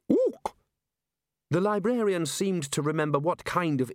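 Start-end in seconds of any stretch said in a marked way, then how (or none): 0.1-0.6 much higher questioning ook; imitating ape. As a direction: mimic the ape's hoot as a question. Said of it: "Ridcully imitating the ape".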